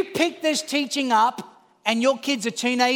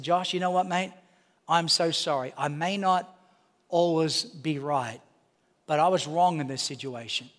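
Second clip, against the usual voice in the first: sincere apology; 7 semitones lower, 5 dB quieter than usual. Retold or imitated. Father apologising to his son, lower and softer, sincere. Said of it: "but I said".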